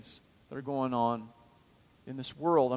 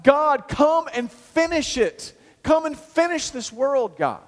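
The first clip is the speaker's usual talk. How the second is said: higher, louder and slow, a pleading call; crying out to God; 11 semitones higher, 7 dB louder, slower than usual. Acted. Calling out to God.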